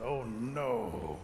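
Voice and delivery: low, drawn-out oh no; acted foreboding realisation; sinking dread. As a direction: let it sink as danger dawns. Turtle dreads the darkness.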